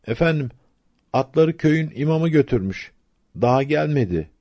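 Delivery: high-pitched, deferential servant voice; storyteller playing an apologetic underling; voiced stableman's reply. A narrator's reading. Stableman answers the angry agha.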